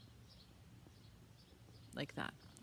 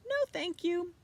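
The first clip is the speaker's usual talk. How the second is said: put-on much higher, polite little girl; 12 semitones higher, 8 dB louder than usual. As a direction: sweetly refuse like a small girl. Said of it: "said Annabelle".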